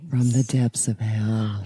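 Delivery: low, slow, ominous. Acted. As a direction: intone it like a demon. Spooky low voice.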